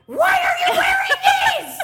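high, loud furious yell through a laugh; yelling at her husband over his smelly shirts; angry yell. Retold or imitated. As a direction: blast it at him in outrage.

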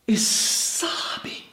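breathy hushed whisper on sobbing. Real, not acted. Preacher describing Jesus weeping over Jerusalem, whispered.

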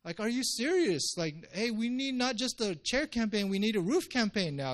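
high, mock-outraged disbelief; exasperated pastor. Pastor scolding the roof-breakers in mock outrage. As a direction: play up the disbelief at the wrecked roof. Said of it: "I'd probably be like".

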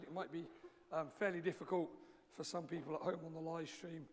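ordinary talk, nothing marked

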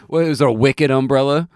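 loud mock Boston accent. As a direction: tease him in a Boston accent. Teasing friend's Boston accent.

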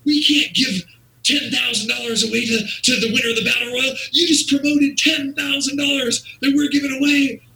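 squeaky high frantic voice of a flustered owner; panicked owner. Retold or imitated. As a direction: play the boss losing his mind in a shrill squeal. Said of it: "This guy's, like, losing it".

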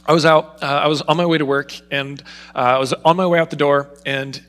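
ordinary delivery

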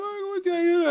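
high mock-helpless wail; imitated tearful wife. Wife asking the pastor for help, high mock-tearful plea. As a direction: plead in a put-on tearful voice. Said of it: "in tears sobbing".

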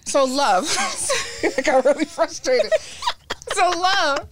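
giggling through words; can't stop laughing. Real, not acted. Laughing fit mid-sentence.

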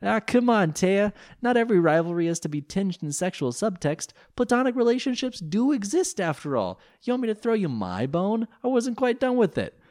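very high, loud cartoon voice; enlightened Joey. A narrator's reading. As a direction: lecture sagely in a squeaky high voice. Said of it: "enlightened-sounding Joey Wheeler".

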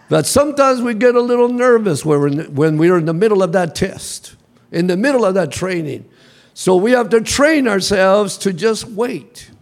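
ordinary delivery

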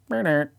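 high buzzer-like noise; imitated weird noise. Telling a dream's odd reset sound, imitated noise. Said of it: "this weird kinda, like, noise".

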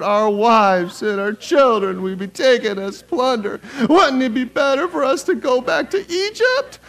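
high, slow, exaggerated group whine; whiny voice. Preacher reading along with congregation as complaining Israelites, whiny voice. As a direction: drag it out as a high-pitched, plaintive complaint. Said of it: "in your whiny, whiny voice".

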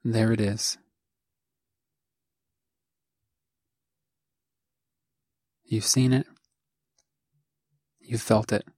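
spare phrases with long silences between; guided meditation. Acted. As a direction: guide the listener gently, leaving room after each phrase. Begins leading the guided meditation.

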